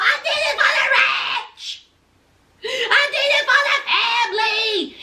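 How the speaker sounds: high, hammed-up bawling; crying. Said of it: "he's crying, snot flying".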